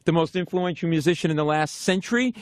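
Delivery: usual speaking voice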